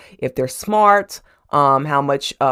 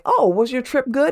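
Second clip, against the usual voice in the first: polite employers; 9 semitones higher than usual. Retold or imitated. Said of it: "they're like".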